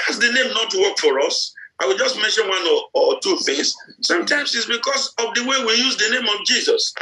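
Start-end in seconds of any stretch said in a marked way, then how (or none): none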